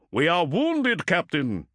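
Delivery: grim, strained ship's captain. A narrator's reading. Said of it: "said Motep grimly".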